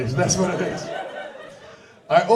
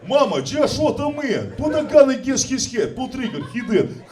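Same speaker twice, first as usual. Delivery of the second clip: louder, higher lines in a thick Russian accent; 6 semitones higher, 5 dB louder than usual. Shown as a demonstration. Parodying rock lyrics in a Russian accent. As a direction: sing it grimly in a Russian accent. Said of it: "anything you do with a Russian accent".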